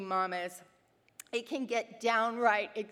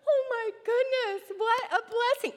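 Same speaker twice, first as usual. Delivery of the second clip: much higher, squealing with delight; overjoyed; 11 semitones higher than usual. Retold or imitated. Mother thrilled at daughter's wish to pray, gushing. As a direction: gush with sudden joy. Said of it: "I'm like".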